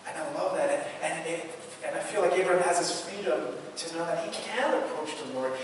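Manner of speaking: usual speaking voice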